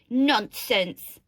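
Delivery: loud dismissive mother; storybook mother's loud telling-off; scolding. A narrator's reading. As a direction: scold it firmly and loudly. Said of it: "Their mother scolded".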